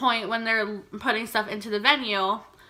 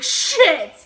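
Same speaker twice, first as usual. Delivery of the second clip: sudden loud curse, caught off guard; startled yell; 6 semitones higher, 9 dB louder than usual. Retold or imitated. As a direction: blurt it out loud as you stumble back. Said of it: "he just yelled".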